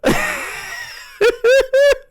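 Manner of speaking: very high, loud staccato cackle; mocking cackle. Real, not acted. Streamer ridiculing an angry chatter, high cackle. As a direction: cackle loudly in high bursts.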